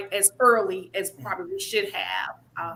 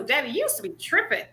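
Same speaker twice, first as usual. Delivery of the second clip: put-on higher teasing child voice; imitating daughters; 8 semitones higher than usual. Daughters teasing father, higher child voice. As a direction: tease daddy like a kid. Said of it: "they would say".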